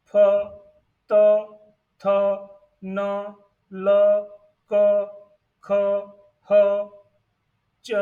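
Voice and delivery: isolated Chinese initials, one by one; pronunciation demo of initials. Shown as a demonstration. Teacher reads the pinyin initials one at a time, demonstrating pronunciation. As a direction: say each initial as a separate loud syllable. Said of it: "pronunciation of each initials".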